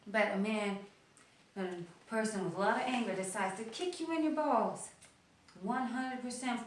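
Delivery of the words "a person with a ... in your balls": usual speaking voice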